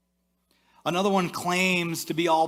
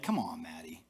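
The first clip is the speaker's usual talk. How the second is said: skeptical whisper; 10 semitones lower, 7 dB quieter than usual. Retold or imitated. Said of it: "You're like".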